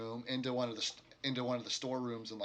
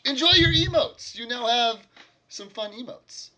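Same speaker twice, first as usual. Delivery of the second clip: high, loud gushing thanks; excited; 12 semitones higher, 14 dB louder than usual. Real, not acted. Thanking a subscriber for the sub, high-pitched and excited. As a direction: squeal it out to the new subscriber.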